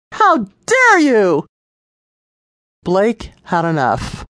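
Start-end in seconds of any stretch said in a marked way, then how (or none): 0.1-1.4 much higher, louder, slow indignant cry; outraged. A narrator's reading. Lisa outraged as the officer pushes her into the car. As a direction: drag it out in disbelief.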